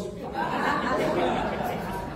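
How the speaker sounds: a quiet unvoiced chuckle; soft breathy laugh. Real, not acted. Pastor laughing at his own off-key childhood. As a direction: laugh under the breath at the memory.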